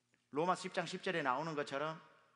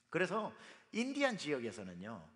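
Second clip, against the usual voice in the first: usual speaking voice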